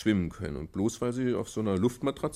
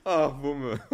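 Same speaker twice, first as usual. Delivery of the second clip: laughing fondly. Acted. Father laughs at the child.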